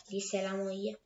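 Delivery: ordinary delivery, nothing marked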